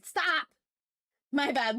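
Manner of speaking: ordinary talk, nothing marked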